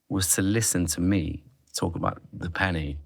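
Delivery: ordinary talk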